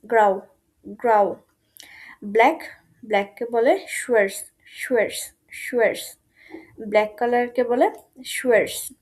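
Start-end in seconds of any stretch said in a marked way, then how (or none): 0.1-1.4 গ্রাউ with an open au glide; German grau. Shown as a demonstration. Teacher drilling the German word গ্রাউ. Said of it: "সেটাকে বলে গ্রাউ". 4.7-6.2 শুয়ার্স with sh and a short glide; German schwarz. Shown as a demonstration. Teacher drilling a hard word, শুয়ার্স. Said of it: "উচ্চারণটা একটু কঠিন মনে হতে পারে".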